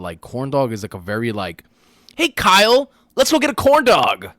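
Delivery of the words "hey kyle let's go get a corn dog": very high, loud silly call; mock an over-eager pal calling out; goofy invitation. Friend mocking corn dog outings, high goofy shout.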